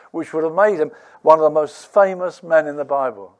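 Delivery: ordinary talk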